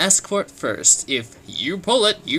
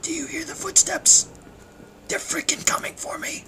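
high, breathless scared whisper; tense whisper; 13 semitones higher than usual. Acted. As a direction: whisper as if they might hear you. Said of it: "whispering in fear".

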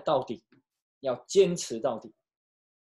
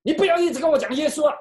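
much higher, louder and fast angry shout; furious yelling father; 12 semitones higher, 8 dB louder, faster than usual. Retold or imitated. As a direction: bark it at the daughter in a rage. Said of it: "醒的时候还是骂他".

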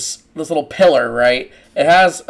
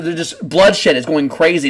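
ordinary talk, nothing marked

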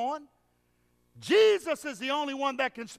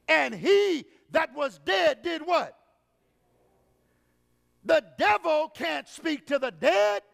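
full-force preaching; 3 semitones higher, 3 dB louder than usual. Acted. Pastor driving home that only Jesus speaks to the dead, full-force preaching. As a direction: preach it out at the congregation.

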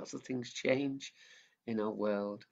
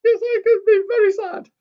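much higher, louder puppet echo; 16 dB louder than usual. Acted. Mickey the puppet echoing the leader, high and loud.

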